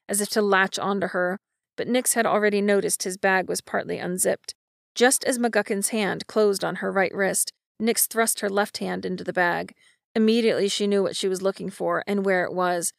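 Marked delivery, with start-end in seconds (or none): none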